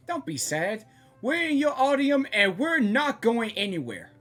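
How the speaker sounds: ordinary delivery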